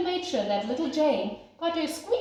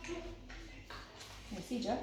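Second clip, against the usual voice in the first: whispered aside; 14 dB quieter, much slower than usual. Real, not acted. Reader whispers to Joe mid-story, hushed aside. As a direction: lean in and whisper the question.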